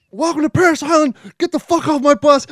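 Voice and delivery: screaming drill instructor, high and loud; drill sergeant yell. Retold or imitated. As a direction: bark it at a bus full of recruits. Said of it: "the drill instructor goes off".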